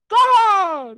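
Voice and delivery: loud, high, rude shout back; respuesta malcriada. Acted. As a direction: yell back rudely at your mother. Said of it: "esa manera no es forma de responder". (grito malcriado a la madre)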